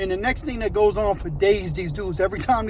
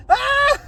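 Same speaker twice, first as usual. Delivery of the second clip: sinister laugh; 11 semitones higher than usual. Retold or imitated. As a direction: imitate a taunting villain's cackle. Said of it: "as he's laughing, he starts saying".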